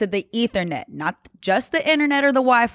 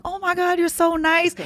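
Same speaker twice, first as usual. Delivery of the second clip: higher, gushing thanks; mock gushing; 9 semitones higher than usual. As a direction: melt at the kindness. Gushing at offered water.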